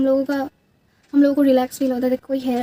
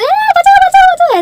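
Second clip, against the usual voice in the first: shrill retold cries for help, much higher and louder; imitated panicked screaming; 11 dB louder, slower than usual. Friend locked in the washroom, screaming in panic. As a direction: scream for help like you're trapped behind a stuck door. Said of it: "चीख रही थी".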